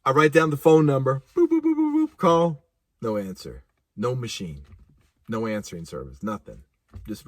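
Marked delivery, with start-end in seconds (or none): 1.4-2.2 high, squeaky dial tones; keypad beeps. Retold or imitated. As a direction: mimic punching in the number. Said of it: "dialing noises".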